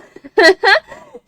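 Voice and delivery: high, loud laugh; big gleeful cackle; burst of laughter. Real, not acted. Laughs after boasting of German classes.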